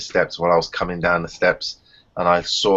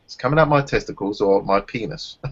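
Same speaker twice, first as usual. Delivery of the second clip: ordinary talk, nothing marked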